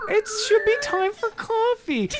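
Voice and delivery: laughing. Acted. Joke, high laughing line.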